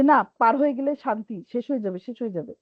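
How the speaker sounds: fast, hurried mock of a student rushing; rushing through. Teacher mimicking students who rush through reading, fast. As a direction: race through the words to get it over with. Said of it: "ব্রুর করে বলে যায়".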